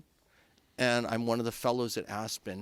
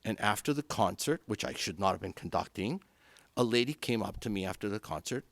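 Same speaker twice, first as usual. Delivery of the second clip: ordinary delivery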